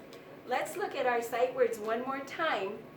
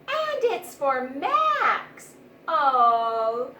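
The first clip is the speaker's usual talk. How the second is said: charmed woman; 10 dB louder, much slower than usual. A narrator's reading. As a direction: linger on Max fondly. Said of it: "said the woman".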